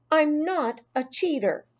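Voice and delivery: high, emphatic protest; indignant child's voice; insistent denial. A narrator's reading. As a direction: stand up for yourself. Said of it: "insisted Heidi".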